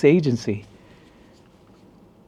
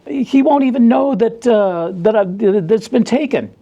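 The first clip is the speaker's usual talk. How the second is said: mock pleading coworker; 8 semitones higher than usual. Coworker begging Ed to let him keep the beer, high whine. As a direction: wheedle like a guilty cop. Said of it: "And he's like".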